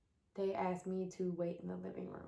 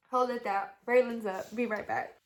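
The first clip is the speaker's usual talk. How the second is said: louder call to someone in the next room; raised voice calling off-mic; 3 semitones higher, 7 dB louder than usual. Real, not acted. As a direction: call across the house, then get back to it.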